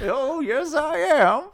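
put-on high, thin old-man voice; lightly hammed-up old grandfather; mock frail grandfather. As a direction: squeak it out like a doddering grandpa. Voicing grandfather in retold scene.

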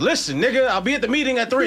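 loud, high, snapping at a coworker; Oakland snap. Pretend. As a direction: bring out the Oakland attitude. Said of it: "You gotta bring that Oakland out of you".

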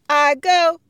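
loud high call. Acted. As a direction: call out to the class. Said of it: "Aisha calls out".

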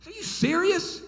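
high-pitched incredulous question; righteous indignation. Retold or imitated. Young pastor questioning a council member, righteous indignation. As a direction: squeal it in disbelief. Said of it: "righteous indignation".

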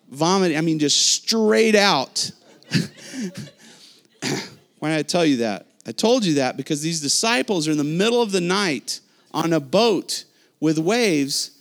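usual speaking voice

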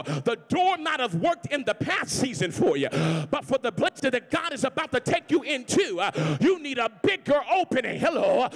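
loud, rising sermon climax; shouting gospel preacher; full-force preaching. Acted. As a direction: preach it out to the back row. Preaching to the congregation.

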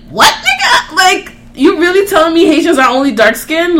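can't believe what she just heard; incredulous. Retold or imitated. Incredulous retort to ignorant coworker.

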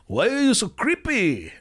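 high-pitched snow monkey character; swooping, high comic voice. Shown as a demonstration. Actor repeating his test line as the snow monkey, comic impression. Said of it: "sound a little bit like [name]".

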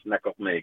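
"Nakokmeek" said in the speaker's own Inuit dialect form. Shown as a demonstration. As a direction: say "Nakokmeek" the way her dialect has it. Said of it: "in my, in my dialect".

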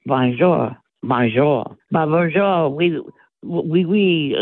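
light mock French accent, greetings drawn out; imitating French greetings. Tan Mom mimics her suitor, lightly hammed-up French accent. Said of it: "uses different words".

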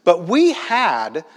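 slow, with weight landing on "had"; stress on "had". Shown as a demonstration. Preacher pointing out the key word, stress on "had". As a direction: draw out and underline "had". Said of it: "notice that key word, had".

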